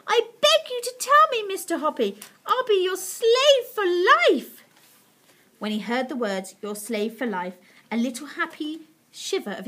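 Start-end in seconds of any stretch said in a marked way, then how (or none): 0.1-4.6 high, loud, desperate plea; begging cry. A narrator's reading. Missus Silver imploring Mister Hoppy, loud desperate begging. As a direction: beg at full voice, stretching the end. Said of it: "cried Missus Silver".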